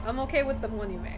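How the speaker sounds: normal talking voice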